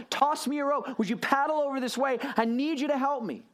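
hammed-up sinking man begging his friends; mock panicked pleading. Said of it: "look back at his friends and say".

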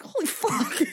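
laughing. Retold or imitated. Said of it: "I'm like".

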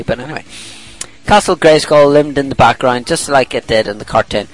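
ordinary delivery

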